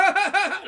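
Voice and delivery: high, loud burst of laughter; imitated laugh. Kid telling how the girl laughed, imitated laugh. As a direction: put on her big laugh. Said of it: "she just laughs".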